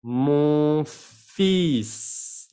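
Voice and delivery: "Mon fils" very drawn out, as "mon feess"; silent L demo. Shown as a demonstration. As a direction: stretch "Mon fils", long hissed S. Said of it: "la L no se pronuncia".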